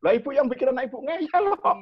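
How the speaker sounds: mock retort. Therapist answers the parent.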